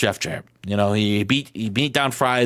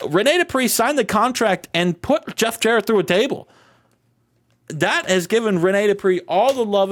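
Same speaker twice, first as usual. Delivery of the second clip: hyped up; 9 semitones higher than usual. Real, not acted. Hyped recap of the big moment.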